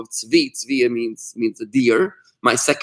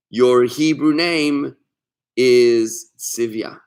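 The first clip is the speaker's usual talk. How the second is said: very slow, each word weighed; slow, deliberate reading; much slower than usual. Shown as a demonstration. Rabbi reads the Rebbe's answer to Ursula aloud, very slowly. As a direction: read out the Rebbe's words slowly and solemnly.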